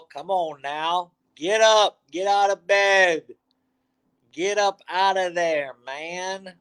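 high, rallying call to get up; wake-up call. Acted. Host urging sleepy viewers out of bed, high rallying call. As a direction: rouse the sleepy audience out of bed.

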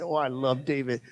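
usual speaking voice

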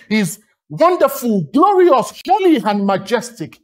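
normal talking voice

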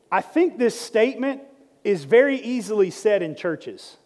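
ordinary talk

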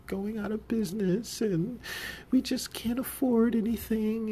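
very high, whimpering imitation; mock crying owner. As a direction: imitate a tearful boss in a squeaky voice. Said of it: "So she starts crying".

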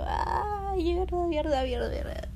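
high-pitched mock panic, falling as it repeats; panicked. Shoplifter reliving her dread at the exit, high mock panic. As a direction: wail it in comic panic, starting very high.

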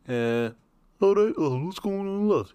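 high-pitched Scouse caricature; mock Scouse accent. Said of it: "heard the exact same person".

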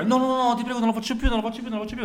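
high, frantic pleading priest. Acted. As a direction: beg desperately, high-pitched.

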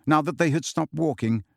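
ordinary delivery, nothing marked

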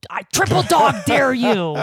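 loud quoted movie dare; loud, hammed-up playground challenge; mock dare. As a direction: shout it like the kid in the film. Quoting A Christmas Story.

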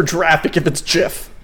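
"graphic" and "gif" said with J sounds; mock soft G. Shown as a demonstration. Mocking a friend's logic, "graphic" and "gif" with J. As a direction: say "graphic" as "jraphic", "gif" as "jif". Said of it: "must stand for graphic".

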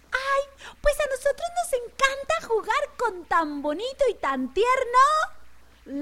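high sweet lamb voice; sweet little lamb. Retold or imitated. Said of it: "qué creen que dijeron los corderitos".

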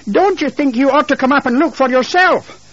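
high, insistent challenge; indignant call over the banister; exasperated wife. Acted. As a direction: call down to your husband, pitched high and reproachful. Wife calling down to her husband.

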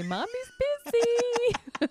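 high, slow mock-sweet "Mommy's busy"; lilting brush-off from behind the door; drawn-out singsong mommy voice; sing-song mom voice. Mom hiding in bathroom.

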